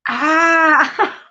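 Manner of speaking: loud, high cry of pain; pained yelp. Real, not acted. Smacked a tooth on the cup, pained yelp. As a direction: yelp from a sudden knock. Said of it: "I just smacked my tooth on my cup".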